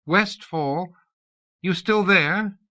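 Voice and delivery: calling out. A narrator's reading. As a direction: call out to someone far off. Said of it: "Elith cried out".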